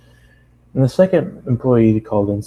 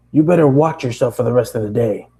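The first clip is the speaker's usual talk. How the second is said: boss warning; 2 semitones higher, 4 dB louder than usual. Acted.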